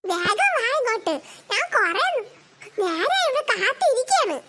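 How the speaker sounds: mock menace from a playground bully; child bully calling the new kids over. Bully child Lallu taunting newcomers.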